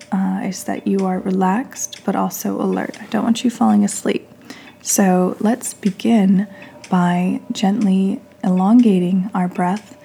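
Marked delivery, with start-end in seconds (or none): 4.8-10.0 guided meditation. Real, not acted. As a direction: lead them gently through each breath, leaving space between phrases.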